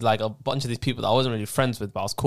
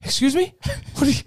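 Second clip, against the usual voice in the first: much higher Irish teacher voice; angry Irish teacher. Retold or imitated. Teacher overhears student's bad word, high mock Irish outrage. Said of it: "he was an Irish guy".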